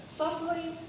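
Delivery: very high squeaky "Suffering?"; mock incredulous. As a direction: act scandalised by the word.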